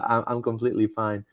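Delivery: normal talking voice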